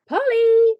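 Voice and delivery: high, loud, drawn-out call; ringing call. A narrator's reading. Child calls for Polly nearby, ringing out. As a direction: sing out the name to summon her. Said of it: "rang a voice dangerously near".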